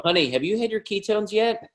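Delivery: high, coaxing honey-voice; nudging a spouse about ketones, sing-song; mock sweet spouse. A spouse asking their partner about ketones, high and coaxing.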